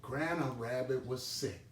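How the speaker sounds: ordinary delivery, nothing marked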